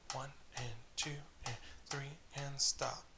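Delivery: soft counting under the playing, no accents; counting low and soft. Shown as a demonstration. Drum teacher counts students through a no-accent round, quietly. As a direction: keep the count hushed and even. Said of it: "it's all low".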